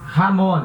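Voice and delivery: Ramon said hamon, raspy h; jamón with a throaty h; Spanish j as h. Shown as a demonstration. Teacher demonstrating Spanish j. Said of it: "sempre vai ter som de R".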